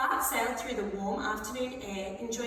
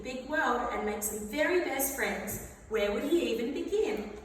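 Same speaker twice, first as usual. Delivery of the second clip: ordinary delivery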